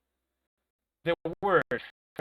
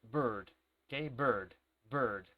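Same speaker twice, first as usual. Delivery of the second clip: American "Bird", R blended into the vowel; low, darker American English "Bird"; American accent; 4 semitones lower, much slower than usual. Shown as a demonstration. Teacher contrasting with British for students, American R-coloured "Bird". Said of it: "En cambio, en americano".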